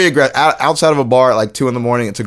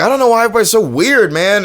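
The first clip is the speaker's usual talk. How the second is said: mock drunk complaint; 9 semitones higher than usual. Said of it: "he was like".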